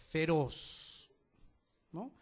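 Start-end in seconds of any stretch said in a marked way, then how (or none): 0.1-1.1 Feroz with a weighty, lengthened ROZ; stress on last syllable. Shown as a demonstration. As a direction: lean on the end of Feroz: fe-ROZ. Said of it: "El énfasis está en la última sílaba".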